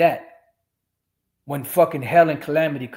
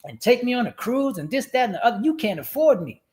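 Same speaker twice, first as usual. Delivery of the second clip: higher, haughty imitation of a demanding woman; mimicking demanding women; 7 semitones higher than usual. Mocking women who demand cruises, haughty and higher. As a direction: play the woman who wants five-star treatment, pitched up. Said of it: "these women today said".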